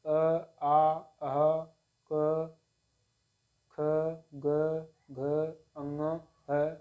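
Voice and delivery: अ, आ, क, ख, ग, घ drawn out slowly from the throat; throat sound demonstration. Shown as a demonstration. Teacher showing learners the throat-made letters, slow demonstrative articulation. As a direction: recite each guttural sound separately, very slow and even. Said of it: "अपने कंठ से बोलते हैं".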